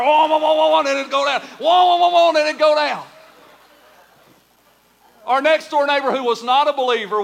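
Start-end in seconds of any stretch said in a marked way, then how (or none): 0.0-0.8 loud, high wailing mower noise; imitated engine cranking. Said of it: "it literally starts cranking up". 1.6-2.3 louder, higher wailing engine imitation; imitated engine cranking. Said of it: "it literally starts cranking up".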